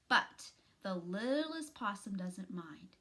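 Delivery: plain reading voice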